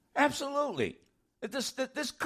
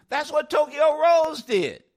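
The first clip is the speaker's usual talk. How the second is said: emphatic; 7 semitones higher, 7 dB louder than usual. Real, not acted. Host declares the comparison.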